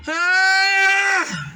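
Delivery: high, loud yelp; a sudden high shout. Real, not acted. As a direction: let out a sharp cry. Sudden outburst.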